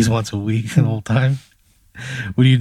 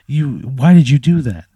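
high, louder hurt outburst; acted angry reproach; 6 semitones higher, 5 dB louder than usual. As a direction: imitate a wife berating her husband. Acted wife berating husband leaving.

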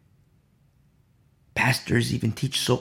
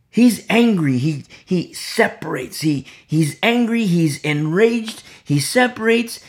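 preaching with force; 4 semitones higher, 6 dB louder than usual. Real, not acted. Preaching Samson's rage.

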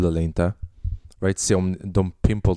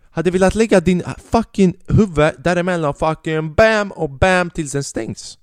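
loud, higher and slowing on the bams; worked-up fantasy; 7 semitones higher, 5 dB louder than usual. Retold or imitated. Comic imagining the lift door slamming, loud and gleeful. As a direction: relish slamming the door on her.

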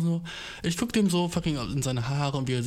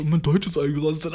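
louder, laughing mockery of the phrase; mocking, laughing; 2 semitones higher, 4 dB louder than usual. Shown as a demonstration. Mocking her own phrase as returnees say it, loud and laughing. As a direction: sneer at it as if it sounds ridiculous. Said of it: "Das hört sich so fucking cock an".

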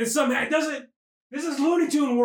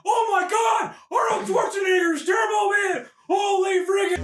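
high-pitched hyped-up shout; mock awe, voice soaring over a crowd; excited announcement; 6 semitones higher, slower than usual. Parade reacting as Turbo Man comes out, wildly excited.

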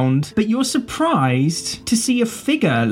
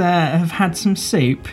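ordinary talk, nothing marked

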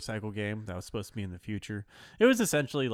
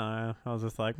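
normal talking voice

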